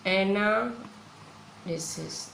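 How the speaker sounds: एनालिसिस drawn out very slowly, ending as -सीज़; slow plural demo. Shown as a demonstration. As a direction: spell out एनालिसिस syllable by syllable. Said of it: "देखो ES लगेगा". (एनालिसिस बहुत धीरे, सीज़ पर ज़ोर)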